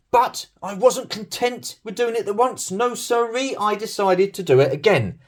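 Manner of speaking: squeaky, self-mocking storyteller voice; mock high voice. Mocks own repeat blunder.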